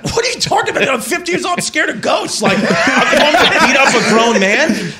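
very high, hammed-up squeal of protest; comic panicked whine, laughter creeping in; incredulous protest. Scared son protesting to dad, high mock disbelief. Acts himself protesting at fifteen.

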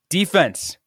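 loud, high, laughing demand; shouting defense. Retold or imitated. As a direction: yell it like a desperate plea. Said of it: "And I'm like".

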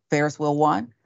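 ordinary talk

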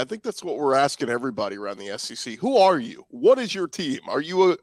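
normal talking voice

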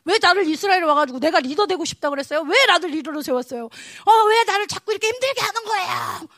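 loud complaint climbing to a high wail; acted defiant protest. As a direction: rail at God, building to an exasperated cry. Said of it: "이런 마음으로 막 하나님을 저항했어요".

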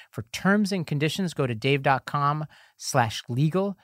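usual speaking voice

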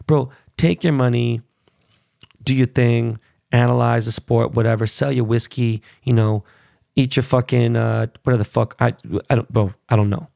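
ordinary talk, nothing marked

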